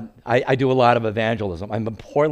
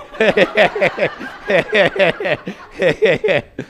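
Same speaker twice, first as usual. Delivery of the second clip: laughing; 8 semitones higher, 4 dB louder than usual. Real, not acted. Laughing at his own joke.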